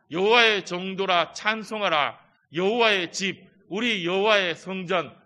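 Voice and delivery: loud, measured scripture reading; psalm recitation. Acted. Congregation reading Psalm 135 aloud, loud and measured. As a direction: proclaim the psalm together.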